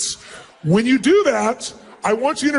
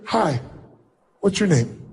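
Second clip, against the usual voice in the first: softer, timid, lower hello; fearful greeting; 3 semitones lower, 3 dB quieter than usual. Shown as a demonstration. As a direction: say hi as if sure you'll be rejected. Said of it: "really go for it".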